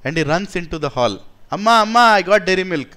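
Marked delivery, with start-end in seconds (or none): 1.6-2.9 put-on loud, high call of an excited child; calling mother. Little son runs into hall calling his mother, excited call. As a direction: shout across the house to mom.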